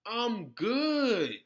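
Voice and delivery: loud, final brush-off with a falling end; loud curt refusal. Real, not acted. Refusing the imagined demand, a loud flat-out no. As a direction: shut it down hard.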